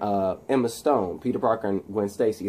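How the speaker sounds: ordinary talk, nothing marked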